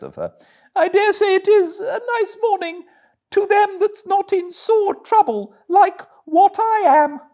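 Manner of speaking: very high, loud falsetto of a put-upon washerwoman; Toad as fussing washerwoman. Acted. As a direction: squeak it up like a harried old laundress pleading her woes.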